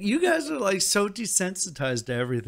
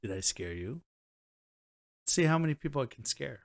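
ordinary delivery

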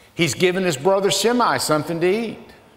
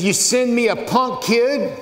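mocking giant; 6 semitones higher than usual. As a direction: sneer it down at the boy.

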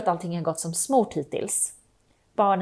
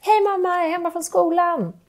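put-on child arriving home; 7 semitones higher, 4 dB louder than usual.

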